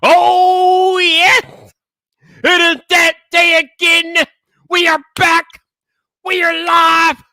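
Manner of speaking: loud hyped-up livestream opener, shouted and stretched out; hype intro shout. Acted. Host opening his Friday night livestream to the chat, a loud hype shout. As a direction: rear back and blast the intro like a ring announcer. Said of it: "deliver an intro as only I can".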